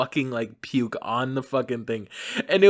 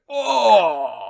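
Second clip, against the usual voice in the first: loud, high mock groan of stomach pain; 6 semitones higher, 6 dB louder than usual. Kid at the carnival with food poisoning, mock groan. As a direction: groan like your stomach is churning.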